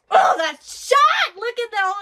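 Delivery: drawn-out, loud and high amazed cry; excited shout. Real, not acted. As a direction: yell it out in awe at the screen. Reacting live to anime fight.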